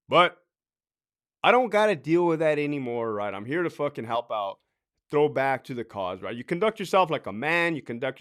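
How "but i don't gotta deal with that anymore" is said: normal talking voice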